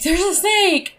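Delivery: high, loud panicked cry of snake; panicked yelp. Retold or imitated. Hiker spotting a garter snake on trail, panicked yelp. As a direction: yelp it like you just saw a snake. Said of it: "I lost my shit".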